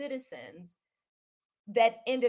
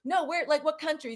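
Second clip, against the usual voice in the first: higher, louder retold pressing; insistent questioning; 7 semitones higher, 6 dB louder than usual. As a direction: imitate her refusing that answer. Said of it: "But, like, where are your parents from".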